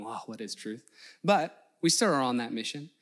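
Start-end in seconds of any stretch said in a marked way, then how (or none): 0.0-0.9 much softer weary question; muttered question. Retold or imitated. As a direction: trail off into a shrug. Pilate going.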